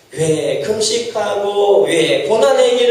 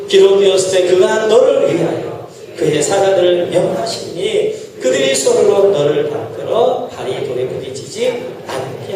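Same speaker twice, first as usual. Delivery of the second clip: slow, lower unison reading; scripture reading; 4 semitones lower than usual. Acted. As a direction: read the verse together, measured. Congregation reads scripture aloud.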